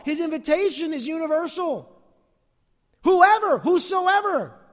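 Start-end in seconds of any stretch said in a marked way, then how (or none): 3.0-4.6 ringing loud proclamation, stretched out; preached shout. Acted. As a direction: call out the word whosoever slowly and loudly. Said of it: "quoting scripture loudly".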